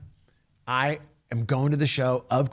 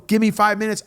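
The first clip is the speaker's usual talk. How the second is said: higher and louder rushed plea; urgent plea; 7 semitones higher, 6 dB louder, faster than usual. Retold or imitated. Host begs his boss for a break, higher and louder. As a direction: beg for just a moment.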